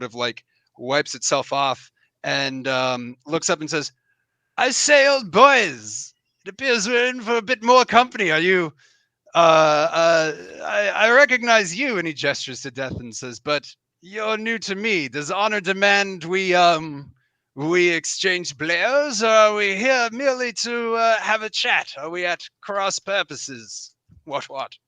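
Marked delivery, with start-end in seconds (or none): 4.6-12.0 put-on high, hearty British gentleman accent; plummy English accent, "old boys" style. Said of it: "going for the [name] special". 13.4-24.8 put-on high British gentleman accent; pompous English knight sizing up a stranger; old-fashioned British accent, raised pitch. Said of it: "going for the [name] special".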